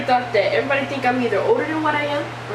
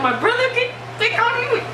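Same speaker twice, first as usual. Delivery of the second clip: indignant retort; 12 semitones higher, 3 dB louder than usual. Retold or imitated. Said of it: "I was like".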